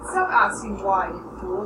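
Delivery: slow, loud, cutting order; mother loses patience; angry. A narrator's reading. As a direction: snap at the boy to stop. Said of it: "her face working up in anger".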